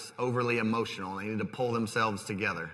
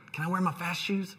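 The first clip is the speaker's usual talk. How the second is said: lightly voiced kid, higher-pitched question; imitated child's plea; 6 semitones higher than usual. Son asking dad for his fast shoes, lightly imitated childlike plea.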